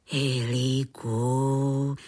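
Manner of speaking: low, long drawn-out call. A narrator's reading. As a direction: call the name slowly, as if just remembering.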